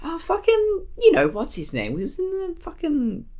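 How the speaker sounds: high, casual searching for a word; swearing as filler. Shown as a demonstration. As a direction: use "fucking" like an offhand "um". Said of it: "I say "fucking" when I say".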